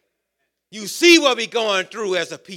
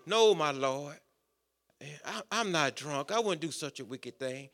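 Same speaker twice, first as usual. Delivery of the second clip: much softer and lower, humble pleading; humble plea; 7 semitones lower, 13 dB quieter than usual. Acted. Hannah humbly answering the priest Eli, soft and low. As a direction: plead quietly and humbly, head bowed.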